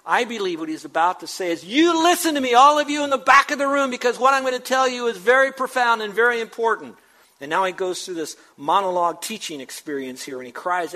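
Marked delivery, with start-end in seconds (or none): 1.6-7.0 high, loud call to the back of the room; crying out. Retold or imitated. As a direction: cry it out to be heard by everyone. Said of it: "And then Jesus cried out in the temple".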